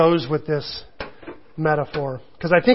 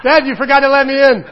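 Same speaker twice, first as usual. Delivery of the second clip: put-on higher, louder kid voice, a touch indignant; imitating son; 6 semitones higher, 8 dB louder than usual. Son at the bathroom door, mock indignant child voice. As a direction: play the little boy scolding his dad. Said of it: "He'll actually say that".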